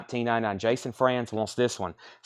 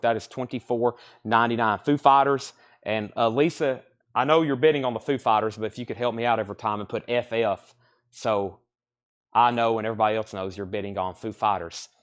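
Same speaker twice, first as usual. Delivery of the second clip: ordinary delivery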